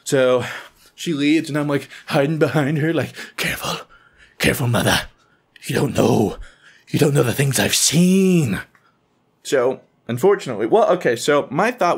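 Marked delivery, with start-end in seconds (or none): 3.4-8.6 hammed-up dire warning. Son hiding behind his mother, melodramatic haunted warning. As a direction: warn her gravely like someone who has seen horrors.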